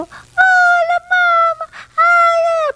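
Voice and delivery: put-on high, loud, squealing baby talk; gushing baby talk. Shown as a demonstration. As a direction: overdo the cooing at the dog. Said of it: "porque él no es así".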